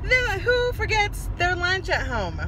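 high, slow, crying whine at herself; whiny cry. Real, not acted. Mom on the road to viewers, real half-crying whine. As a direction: wail it like you could cry over a lunch. Groaning over her forgotten lunch.

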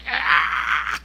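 unvoiced roar of rage; Hulk-like furious growl. Imitating the furious organizer with veins bulging, Hulk-like roar. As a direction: strain out a mock monster roar. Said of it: "parecía Hulk".

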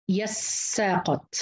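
slow يساقط as "yas-saa-qat"; ya with doubled sin. Shown as a demonstration. Teacher sounding out Ya'qub's reading, slowly. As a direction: draw out يساقط, hold the س, a on ق. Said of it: "وتشديد السين وفتح القاف".

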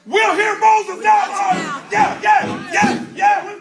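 high, loud, slow hollering; hollered out. Retold or imitated. The people crying out to Moses, loud high holler. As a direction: holler it like the crowd. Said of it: "what they hollered out".

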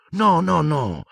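very high-pitched, falling away at the end; protesting. A narrator's reading. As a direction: hastily deny it in distress. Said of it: "protestò Hermione". (protesta acuta, allarmata)

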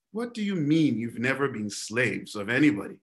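low, soft, scoffing question; incredulous mock retort.

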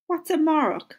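ordinary talk

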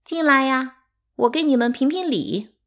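high, loud summons; ringing, imperious shout; calling out. A narrator's reading. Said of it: "三妈突然叫起来".

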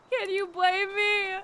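high, loud, drawn-out wail; breaking up laughing. Real, not acted. Streamer defends herself after cracking up, laughing wail. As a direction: wail it while recovering from laughter.

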